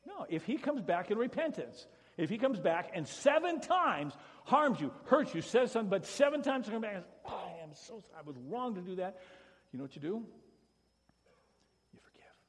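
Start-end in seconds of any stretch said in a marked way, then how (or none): 0.0-9.2 loud, higher, fervent preaching; preaching at full force. Real, not acted. Preaching climax. 11.9-12.4 whispered answer; whispering the key word to the church. Real, not acted. Whispered punchline.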